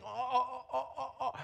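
high, giggly eager oh-ohs; eager Peter. Acted. Peter bursting to cut in on Jesus, giggling eagerly. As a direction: squirm like a kid dying to talk.

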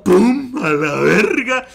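loud, high pum, then stretched words; exaggerated mimicked punchline; mock boast. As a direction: parrot the thief's brag, hammed-up.

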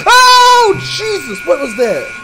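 shrieking high in sheer fright; terrified scream. Real, not acted. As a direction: scream out, truly startled. Jump scare in horror game.

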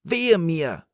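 high, loud wail of woe; acted shriek of a stricken wife; cried out. A narrator's reading. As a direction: cry out in sudden pain. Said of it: "schrie die Frau".

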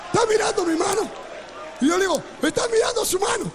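incredulous high-pitched cry; astonished. Retold or imitated. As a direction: blurt it out amazed. Astonished reaction to miracle.

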